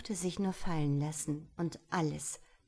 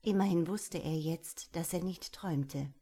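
ordinary delivery, nothing marked

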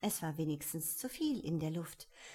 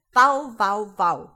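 very loud barking yells; barked chorus; 2 semitones higher, 15 dB louder, slower than usual. Acted. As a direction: bark it out like a dog chorus. Cook and baby join the chorus. (laut bellend gerufen)

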